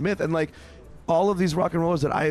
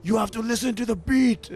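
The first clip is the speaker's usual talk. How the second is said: put-on intense old man; 8 semitones higher than usual. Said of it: "He's like".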